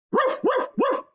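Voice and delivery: high, sharp woofs; high-pitched excited barking; dog barking. A narrator's reading. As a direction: bark the words like a dog. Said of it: "barked Randolph".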